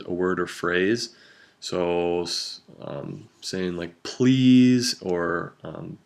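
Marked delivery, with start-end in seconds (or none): 4.0-5.0 "Please" pushed much higher and louder; emphasized please. Shown as a demonstration. Showing students how to stress "Please", pleading and emphatic. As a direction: lean hard on "Please" like a plea. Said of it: "how we emphasize the word please".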